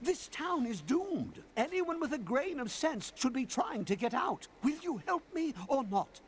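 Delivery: haughty. Acted. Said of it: "they give a lot of sass to everyone".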